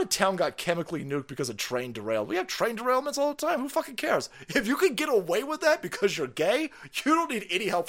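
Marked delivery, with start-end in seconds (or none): 2.3-7.9 higher mocking voice; mock falsetto. Mocking the official, higher. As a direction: sneer it up high.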